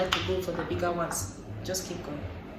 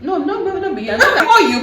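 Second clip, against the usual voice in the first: laughing protest; 10 semitones higher, 10 dB louder, faster than usual. Real, not acted.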